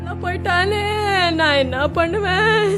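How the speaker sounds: tearful keening over a lost son; wailing lament. Acted. Mother wails over her dead son, tearful lament. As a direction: mourn aloud, voice breaking now and then.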